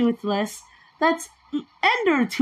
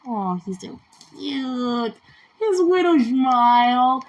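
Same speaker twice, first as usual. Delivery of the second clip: ordinary talk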